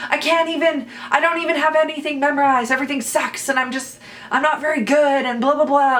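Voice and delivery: high, rushing, hammed-up self-criticism; retold rant. Actor re-enacting her off-book meltdown to a castmate, high-pitched ranting. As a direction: rant away, imitating your own meltdown. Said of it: "I was just ranting to him, going off".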